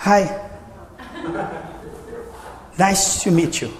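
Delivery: mock English greeting. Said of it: "eu digo".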